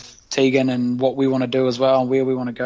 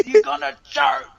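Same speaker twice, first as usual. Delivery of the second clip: yelled taunt; 11 semitones higher than usual. Retold or imitated. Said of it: "the Māori boy rocks up".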